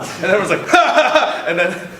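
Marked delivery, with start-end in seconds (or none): 0.8-1.4 much higher, loud burst of laughing; mock crowd laughter. Everyone at Comic-Con laughing at his pants dropping, loud high burst.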